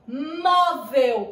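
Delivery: "Móvel" with a long, loud MÓ; stress on first syllable. Shown as a demonstration. Teacher showing pupils the accent, "Móvel" stressed on MÓ. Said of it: "olha onde tá o acento".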